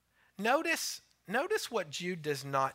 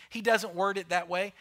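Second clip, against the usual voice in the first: ordinary delivery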